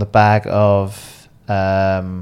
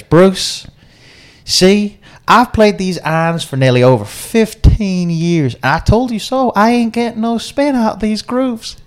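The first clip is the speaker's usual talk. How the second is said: high, slow, hammed-up Southern American drawl; southern American accent; 10 semitones higher, slower than usual. Golfer tells Bruce his old irons get no spin, in a slow Southern American accent. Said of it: "is [name]'s southern American accent good or bad".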